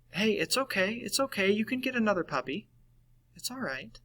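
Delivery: high, soft coaxing friend voice; dismissive consoling friend. Acted. First friend hearing your sad puppy story, dismissively consoling. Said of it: "Your first friend, as you're telling them the sad story".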